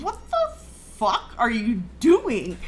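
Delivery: slow, drawn-out bewilderment; baffled disbelief. Retold or imitated. Wife silently wondering at his fumbling with salt, baffled. Thinking while watching husband fumble.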